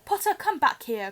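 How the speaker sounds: a shouted command across the room; shouting. Acted. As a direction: bark him back, loud. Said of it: "Quirrell shouted".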